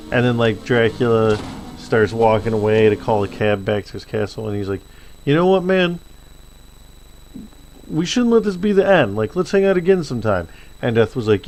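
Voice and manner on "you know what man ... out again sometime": much higher, eager friendly invite. Retold or imitated. Dracula asks Death to hang out again, eager and higher. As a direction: reach out like a new buddy.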